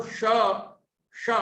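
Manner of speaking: श said with the tongue curled back; retroflex sh. Shown as a demonstration. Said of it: "जीव को पीछे की ओर मोड़कर बोलेंगे".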